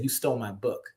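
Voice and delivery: flat, soft and sure of himself; a quiet cold accusation; cold calm certitude. Retold or imitated. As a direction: state it coldly, no heat. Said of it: "said it with a cold, calm certitude".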